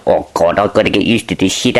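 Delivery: ordinary delivery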